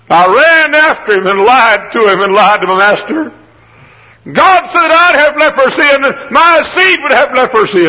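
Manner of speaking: high, loud, impassioned confession; anguished outcry. Acted. Gehazi confesses lying to his master, crying out in anguish.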